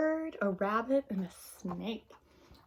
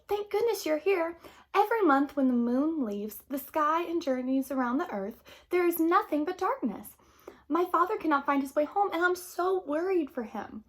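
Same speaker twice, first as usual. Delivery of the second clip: higher, pleading and worried; tearful plea; 7 semitones higher, 8 dB louder than usual. Acted. Girl telling the sun her father is lost, a mild high tearful plea. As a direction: beg the sun through light acted tears. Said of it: "the girl sobbed".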